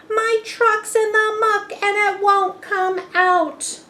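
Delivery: high, loud shout for help. A narrator's reading. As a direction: call out from the stuck truck. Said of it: "hears the shout".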